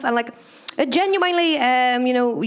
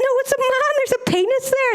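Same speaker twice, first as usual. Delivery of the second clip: much higher, flustered squeak; flustered protest; 14 semitones higher than usual. Retold or imitated. Insisting to granny the naked man isn't her, squeaky high. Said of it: "And I went".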